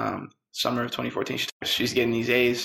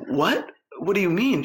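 sharp, high "what?" of disbelief; surprised; 7 semitones higher than usual. Retold or imitated. Startled by mother's question.

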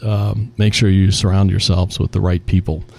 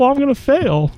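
mock tearful employee. Retelling crying employee.

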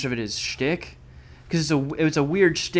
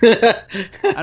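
laughing; 9 semitones higher, 10 dB louder than usual. Real, not acted. Laughing after joke.

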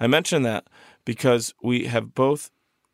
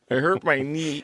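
mock complaint; 4 semitones higher than usual. Playful echoes of hurt knee.

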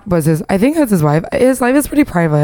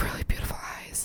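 whispering; 20 dB quieter, much faster than usual. Real, not acted. Admiring the eyes.

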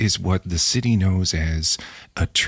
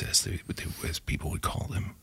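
whispering; 11 dB quieter than usual. Acted. Unvoiced hushed aside.

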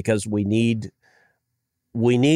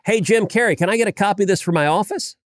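higher-pitched, playful call-out; quick, mock-friendly ask of a celebrity; retold cheeky request; 9 semitones higher, faster than usual. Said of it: "I tweeted the picture out and I said".

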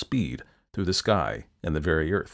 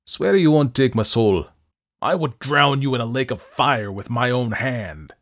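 loud, raging demon threat; furious devil; 4 semitones higher, 6 dB louder than usual. A narrator's reading. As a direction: rage and threaten from above.

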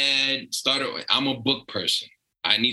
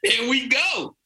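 hyped echo; 9 semitones higher, slower than usual. Real, not acted. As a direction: jump in with a pumped-up echo. Echoing host, hyped up.

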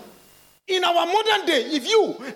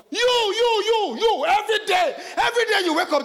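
excited; 9 semitones higher than usual. Real, not acted. Pointing at congregation, excited.

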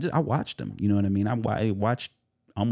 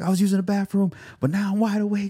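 higher, louder hyped voice; excited; 14 semitones higher, 6 dB louder than usual. Retold or imitated. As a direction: burst with early-morning hype. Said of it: "I was like".

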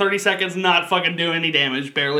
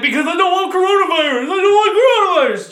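much higher, louder mock old lady shriek; old woman on the bus; 13 semitones higher, 5 dB louder than usual. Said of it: "that's why she sounds like that".

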